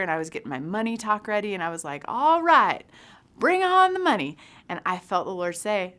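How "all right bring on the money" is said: much higher and louder, pumped up; rally yourself for the cash; eager hype. Retold or imitated. Retelling eager money talk prep.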